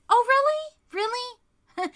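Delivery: high-pitched, hammed-up "oh, really?"; exaggerated high incredulity; mock surprise. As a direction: throw it back at them in mock disbelief. Acts out men fending off women.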